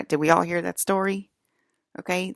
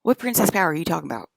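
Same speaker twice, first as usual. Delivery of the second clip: indignant; 7 dB louder, faster than usual. Real, not acted. Indignant challenge to coworker.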